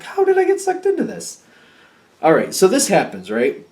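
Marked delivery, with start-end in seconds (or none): 0.0-1.4 very high, held-up mock wail; high-pitched disbelief. Reader repeats the roped-in person's complaint, high mock disbelief. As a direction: push the question way up high in disbelief.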